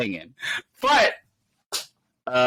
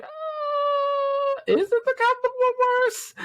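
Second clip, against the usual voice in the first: high, squeaky confused question; mock high quote; slower than usual. Retelling his own confused question in a squeaky voice. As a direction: squeak it out like a puzzled kid. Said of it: "I was like".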